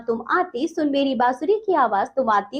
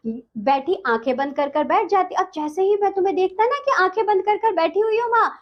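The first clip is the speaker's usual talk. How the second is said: ordinary talk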